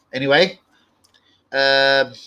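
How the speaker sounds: ordinary delivery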